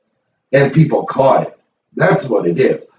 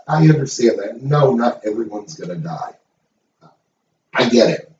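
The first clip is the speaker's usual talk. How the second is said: ordinary talk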